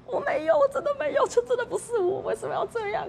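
high, desperate protesting voice; imitated frantic high denial; mock pleading denial. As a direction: squeal out the denial in panic. Retelling own frightened denial.

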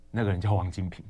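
hushed confession in a whisper. Real, not acted. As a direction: whisper the name like a secret. Whispered punchline of drinking story.

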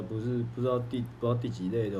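ordinary delivery

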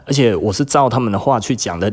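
ordinary talk

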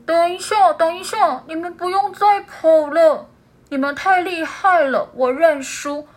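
high, loud urgent pleading; loud call. Acted. Big seal shouting for the otter and owl to stop. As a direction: call them back before they flee. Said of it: "很大声地说".

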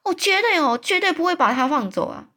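high, loud vow; shouting. A narrator's reading. Giant refuses to free the nightmare, loud vow. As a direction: shout it out firmly, never letting go. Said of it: "友善大巨人就开始叫嚷起来".

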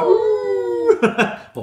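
high, loud wolf howl. Pretend. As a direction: howl like a big bad wolf. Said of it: "你们会学大野狼的声音吗".

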